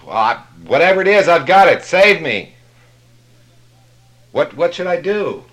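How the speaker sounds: loud, high desperate yelling; frantic pleading. Retold or imitated. Climber shouting up to God's voice, loud and high. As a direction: beg at the top of your voice.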